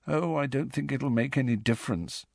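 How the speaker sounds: soft, dejected mumble; miserable. Acted. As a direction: say it with no hope left. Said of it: "still more miserably".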